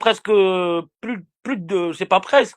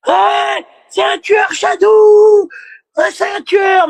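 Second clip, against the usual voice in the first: much higher, louder mock shriek; mock hysterical scream; 11 semitones higher, 5 dB louder, much slower than usual. Streamer imitating her screaming at Shadou, mock shriek. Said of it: "elle voulait porter plainte".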